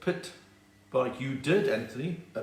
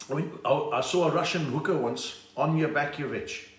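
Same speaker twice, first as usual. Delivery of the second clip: ordinary delivery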